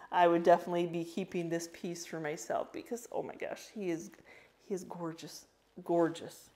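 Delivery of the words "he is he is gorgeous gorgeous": normal talking voice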